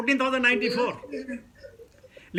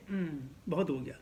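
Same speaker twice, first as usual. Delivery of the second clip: bored sigh; 7 semitones lower, 11 dB quieter than usual. Retold or imitated. Bored listener's inner voice.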